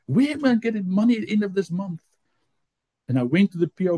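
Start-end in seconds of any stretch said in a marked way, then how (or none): 0.1-2.0 high, worried demand; retold complaint, pitched up; frustrated. Worker short of money questions God, frustrated. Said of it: "I get more frustrated".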